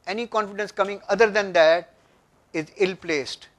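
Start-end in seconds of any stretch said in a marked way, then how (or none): none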